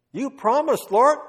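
loud, high, insistent prayer; bold reminder. Retold or imitated. Corrie reminding God of promise.

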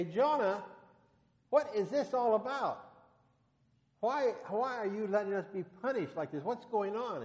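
high, loud, pleading demand; frantic sailors. Retold or imitated. Sailors in the storm demanding answers from Jonah, frantic and loud. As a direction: cry out at him in panic. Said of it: "and they say".

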